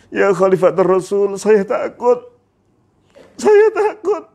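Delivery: high voice climbing into a wail; acted tearful dread, building; crying in fear. Bilal answering the caliph, crying in fear. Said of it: "mengapa kamu menangis sampai seperti ini".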